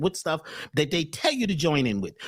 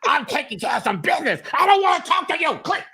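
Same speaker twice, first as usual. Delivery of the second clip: mock angry yell; 4 semitones higher than usual. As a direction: cut them off harshly. Lady yells at other caller.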